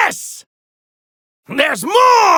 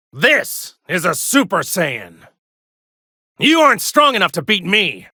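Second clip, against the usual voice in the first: low taunt; 8 semitones lower than usual. Acted. Villain-style boast.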